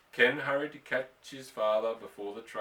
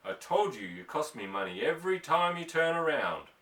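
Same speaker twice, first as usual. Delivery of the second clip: loud, raised angry roar at his son; 5 semitones higher, 5 dB louder than usual. Acted. Father roaring at son over hay, loud and angry. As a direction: let it rip, a father losing patience. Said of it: "He roared".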